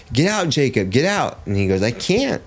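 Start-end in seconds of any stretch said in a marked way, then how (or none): 0.1-1.4 high, insistent mock yell; retold coach yelling. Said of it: "the coach is yelling at my kid". 1.8-2.4 very high mock child's wail; overwhelmed kid. Overwhelmed son answers coach.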